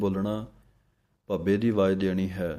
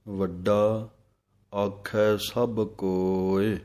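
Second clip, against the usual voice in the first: ਵੱਡਾ, then a clear pause before ਆਖੈ ਸਭ ਕੋਇ; pause after ਵੱਡਾ; much slower than usual. Shown as a demonstration. Teacher showing students the pause after ਵੱਡਾ. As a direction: break after ਵੱਡਾ and draw out ਆਖੈ slowly. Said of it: "ਵੱਡਾ ਦੇ ਉੱਤੇ ਵਿਸਰਾਮ ਹੈ".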